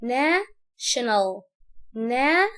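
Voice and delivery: national as a rising "na", pause, then "shenal"; split in two. Shown as a demonstration. Teacher splitting a big word, national in two slow pieces. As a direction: break national slowly after a drawn-out "na". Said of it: "الكلمة لما تبقى كبيرة عليا اقسمها".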